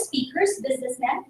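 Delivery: normal talking voice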